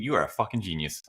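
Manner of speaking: ordinary talk, nothing marked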